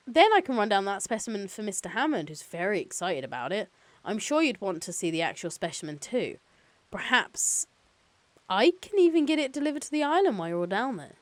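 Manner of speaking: lightly voiced eager corporate counsel; upbeat phone-call schmoozing; chipper company lawyer.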